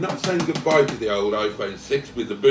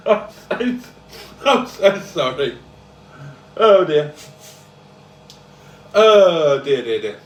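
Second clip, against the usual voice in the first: laughing; 5 semitones higher, 7 dB louder, slower than usual. Real, not acted. Laughing at own nose-blowing.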